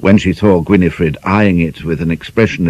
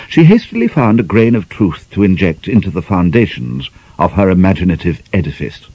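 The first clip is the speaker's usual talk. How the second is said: usual speaking voice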